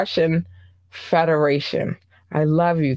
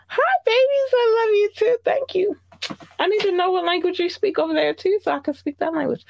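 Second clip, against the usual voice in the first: put-on much higher, sweet baby-talk gushing; cute baby voice. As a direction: coo at the fans like they're puppies.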